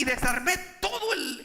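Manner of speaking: ordinary talk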